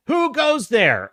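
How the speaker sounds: high, slow call across the bridge; a guard calling out, who goes there; stranger calling out. Retold or imitated. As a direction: challenge them from far off.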